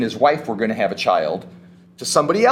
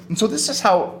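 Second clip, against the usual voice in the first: usual speaking voice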